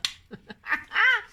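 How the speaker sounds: loud, high burst of laughter; big self-amused cackle; real laugh. Real, not acted. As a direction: burst out laughing at your own remark. Laughs at her own defiant remark.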